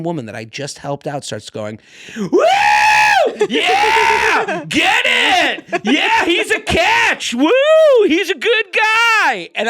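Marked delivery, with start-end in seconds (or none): 2.2-9.5 loud, very high drawn-out hollers; mock a rowdy onlooker cheering them on; whooping cheer. Helped woman cheering a kiss from nearby, whooping.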